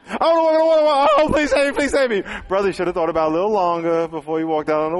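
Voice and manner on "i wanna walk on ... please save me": high, loud panicky cry for help; panicked Peter. Retold or imitated. Sinking Peter begs Jesus to save him, high and panicked. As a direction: squeal it out like a sinking man begging.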